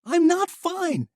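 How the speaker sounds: very high, loud and slow; shouting. Acted. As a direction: drag every word out in a shout. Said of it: "Misha shouted again".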